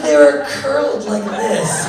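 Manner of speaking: slow mock wife. Wife describes toes.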